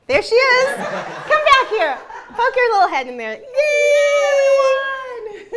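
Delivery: excited, high and laughing. Real, not acted. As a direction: greet her with delight. Greeting a friend who appears.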